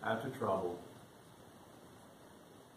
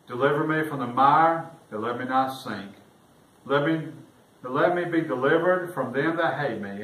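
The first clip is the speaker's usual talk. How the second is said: raised, louder solemn reading of the psalm; preacher reading the King James lament to the congregation, raised and solemn; scripture reading; 2 semitones higher, 4 dB louder than usual. Acted. Reads psalm scripture aloud.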